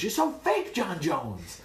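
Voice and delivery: very high, loud accusation; indignant high-pitched call-out; Rashad Evans. Retold or imitated. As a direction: squeal it in mock outrage. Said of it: "I felt like Rashad Evans".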